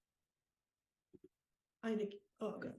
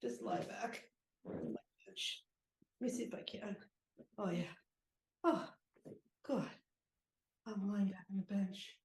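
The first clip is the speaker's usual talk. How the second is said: relieved; 3 semitones lower, 7 dB quieter than usual. Acted. Sore player eases onto bench.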